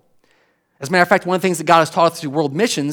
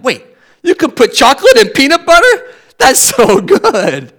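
much higher, louder, astonished; amazed adult; 8 semitones higher, 7 dB louder than usual. Retold or imitated. Pastor as adult discovering the candy, amazed and loud. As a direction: gush in wide-eyed disbelief. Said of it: "When I was an adult, I'm like".

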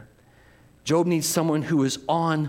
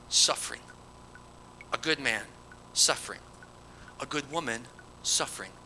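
hushed solemn litany; 7 dB quieter than usual. Acted.

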